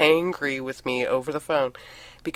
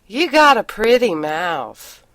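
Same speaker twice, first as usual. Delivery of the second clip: slow, louder Southern drawl; 5 semitones higher, 6 dB louder, slower than usual. Pretend. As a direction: stretch it out in a playful Southern drawl. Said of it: "he wants it with the drawl".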